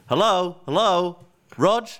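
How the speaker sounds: drawn-out, high-pitched phone hello; retold calling out for an answer. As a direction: call into the phone, pitch lifted.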